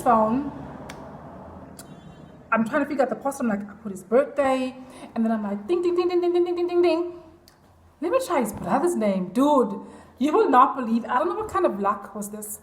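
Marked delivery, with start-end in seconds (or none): 5.7-7.1 quick run of high dings; imitated keypad dinging. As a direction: chant dings on one raised note. Imitates typing in the password.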